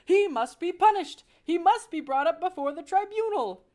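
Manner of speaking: high, loud furious shout; enraged father mouse. Acted. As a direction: demand punishment at full voice. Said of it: "shouted Lester".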